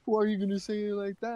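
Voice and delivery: high, put-on hurt voice; mock offended protest. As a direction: imitate an offended friend. Mimics the offended friend's reply.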